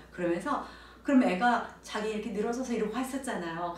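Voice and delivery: normal talking voice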